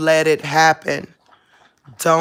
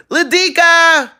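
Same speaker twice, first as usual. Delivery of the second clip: high, loud, drawn-out call; 12 semitones higher, 7 dB louder than usual. Real, not acted. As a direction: stretch the dog's name into a holler. Calling out to dog.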